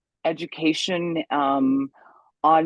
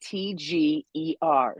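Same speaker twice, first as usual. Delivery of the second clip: slow letter-by-letter spelling; unhurried, separated letters; spelling out loud; 4 semitones higher, slower than usual. Shown as a demonstration. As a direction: spell it out slowly, one letter at a time. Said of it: "How do you spell it?".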